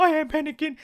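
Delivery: high, dubbed panicked voice; panicky voice. Acted. Vlogger's dubbed inner panic, high-pitched.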